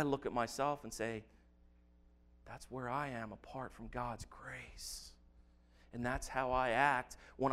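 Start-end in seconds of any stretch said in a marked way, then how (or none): 2.4-5.1 whisper. Real, not acted. Quiet confession to himself.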